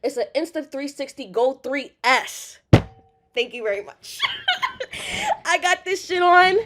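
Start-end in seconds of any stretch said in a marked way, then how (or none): 4.2-5.4 high squealing laugh. Real, not acted. Nervous laugh.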